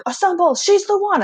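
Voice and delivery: rally the spider troops against her; spider army. Pretend. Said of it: "everyone's just like".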